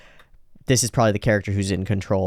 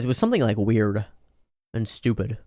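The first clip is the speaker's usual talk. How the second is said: ordinary delivery, nothing marked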